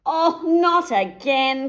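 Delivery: slow, high, loud groaning cry; wolf landing in hot water; cried out. A narrator's reading. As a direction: wail it, fed up. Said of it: "cried the wolf".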